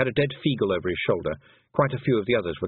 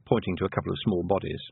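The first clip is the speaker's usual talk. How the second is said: usual speaking voice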